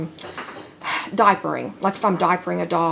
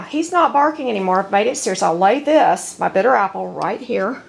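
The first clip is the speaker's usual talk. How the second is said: normal talking voice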